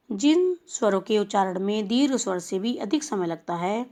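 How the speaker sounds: normal talking voice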